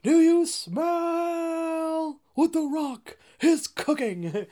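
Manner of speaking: high, loud, drawn-out wrestling catchphrase; The Rock. Acted. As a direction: stretch it out like a hyped-up wrestler's boast. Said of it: "I've seen you be like".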